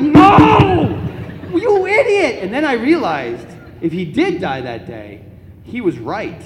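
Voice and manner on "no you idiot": long, high, loud wail of protest; outraged yell. Retold or imitated. Child alone in the car after dad drives off, outraged yell. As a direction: howl it in exasperation.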